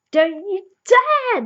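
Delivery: drawn-out, high, loud shriek of protest; slow high shout of outrage; outraged scold. Pretend. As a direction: wail it out slow and furious at her. Her outburst at the old lady.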